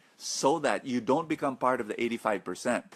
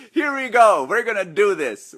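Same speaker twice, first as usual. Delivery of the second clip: excited rally; 8 semitones higher, 9 dB louder than usual. Real, not acted. Hyping live audience to start.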